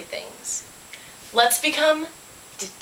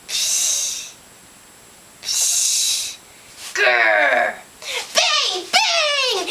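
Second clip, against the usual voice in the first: long hushes, a growl, high bangs; hushing and growling, then high ringing bangs; imitated monster noises; 14 semitones higher, much slower than usual. A narrator's reading. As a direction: act out each scary sound. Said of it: "It goes".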